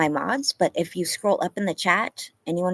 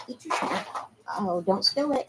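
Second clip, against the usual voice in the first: ordinary talk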